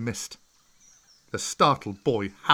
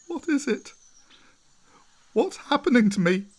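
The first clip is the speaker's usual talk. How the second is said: much higher, panicked questions; frightened cry; 13 semitones higher, 5 dB louder than usual. A narrator's reading. Mowgli crying out in fear at his first tears, a high panicked voice. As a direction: cry out, scared and confused. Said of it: "he cried out".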